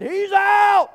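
loud, high shouted call; umpire's call. Retold or imitated. Umpire calling the runner out, loud and high. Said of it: "but the umpire said".

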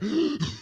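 quick, high, gulping gasps; hyperventilating gasps. Shown as a demonstration. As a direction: mimic panicked overbreathing in short gasps. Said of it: "you can't go out there".

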